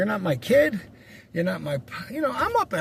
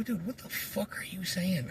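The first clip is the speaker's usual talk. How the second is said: much softer, tense rebuke under the breath; hushed rebuke; 10 dB quieter than usual. Retold or imitated. Scolding his friend while walking past the girl, hushed. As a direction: scold him so the girl can't hear.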